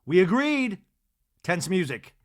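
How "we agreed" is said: higher, louder mock confrontation; lightly voiced indignant housewife; Angie. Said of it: "Angie says".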